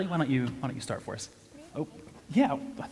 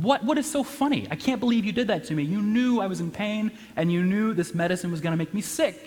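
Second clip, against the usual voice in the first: indignant outburst; 7 semitones higher than usual. Retold or imitated. Retelling how he confronted his friend, indignant and high-pitched. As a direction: play the wronged friend demanding answers.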